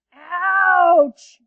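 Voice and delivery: loud, high yelp of pain; she-panther stung between her toes, loud; pained growled ouch. Acted. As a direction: cry out from the sting. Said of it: "growled the she-panther".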